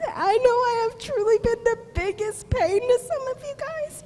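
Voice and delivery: fighting back tears while thanking teachers; choked up. Real, not acted. Student thanking her teachers, close to tears. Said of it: "forgive me if I get emotional".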